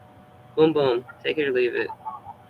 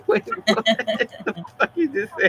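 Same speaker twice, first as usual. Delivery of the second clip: shocked disbelief; 12 semitones higher than usual. Retold or imitated.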